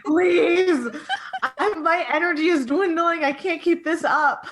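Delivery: high, laughing plea of a worn-out dog; exhausted dog. Pretend. Friend voicing the tired beagle, high and laughing. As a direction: beg like a dog out of energy. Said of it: "He's like".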